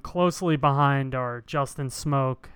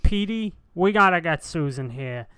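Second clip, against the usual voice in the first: louder and higher excited Boston play-by-play; Boston broadcaster; 4 semitones higher, 5 dB louder than usual. Pretend. Playing the Boston announcer opposite Susan, louder excited call. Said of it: "you be him".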